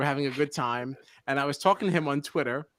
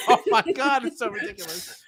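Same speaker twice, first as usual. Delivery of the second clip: laughing disbelief; 9 semitones higher than usual. Retold or imitated. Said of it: "And I was like".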